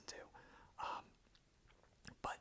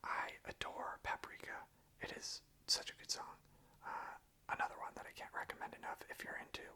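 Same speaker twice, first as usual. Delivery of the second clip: normal talking voice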